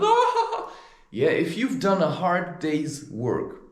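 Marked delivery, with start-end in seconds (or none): 0.0-0.7 high, satisfied "ah" of a drinker; mock the idle drinker's contented sigh; lazy drunk sigh. Mocking the men sitting around drinking, lazy high sigh. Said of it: "Just sit around like".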